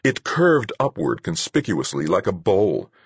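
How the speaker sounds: ordinary delivery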